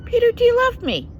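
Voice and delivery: quick, high pressing question; voicing Jesus again. Jesus asking Peter again, quick and high. Jesus asks a second time.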